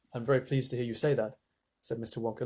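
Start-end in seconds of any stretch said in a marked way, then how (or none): 0.1-1.4 low-key, earnest approval; serious. A narrator's reading. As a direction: say it gravely, quite restrained. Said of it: "looking more serious than ever".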